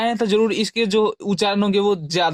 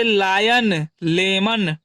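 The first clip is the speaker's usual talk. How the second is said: slow, drawn-out lion; teacher modelling lion slowly; slow example word; much slower than usual. Shown as a demonstration. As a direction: stretch lion for the class. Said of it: "जैसे".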